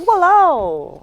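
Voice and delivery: exasperated Singaporean accent. Shown as a demonstration. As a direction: Singlish exclamation of dismay. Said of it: "You're like".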